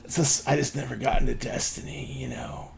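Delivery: soft, halting, whispery voice; barely above a whisper. Acted. Weird guy admitting he never got into Destiny, whispery. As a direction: trail along in a near whisper. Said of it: "in this voice that's barely above a whisper".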